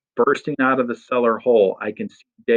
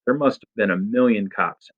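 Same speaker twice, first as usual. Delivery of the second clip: ordinary delivery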